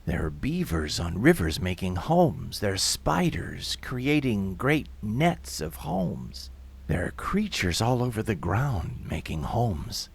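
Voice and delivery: soft, low, lingering dreamlike telling; hushed story narration. A narrator's reading.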